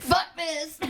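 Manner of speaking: high, laughing mock outburst; fed-up listener. Listeners switching off the podcast, fed up. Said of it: "everybody switches off our podcast. They're like".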